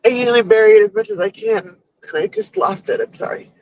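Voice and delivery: sobbing out the words, voice jumping high; choking on frustration, real tears; crying. Real, not acted. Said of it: "I don't know why I'm crying".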